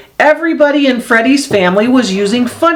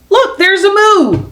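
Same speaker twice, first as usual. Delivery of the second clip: put-on high, bright little boy voice; childlike voice; 13 semitones higher than usual. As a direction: play young Freddie pointing excitedly. Child Freddie voiced in story.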